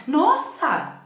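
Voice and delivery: Nossa cried out high and loud; an amazed, high Nossa; amazed exclamation. Shown as a demonstration. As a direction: gasp out Nossa in wonder. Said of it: "É como a gente fala".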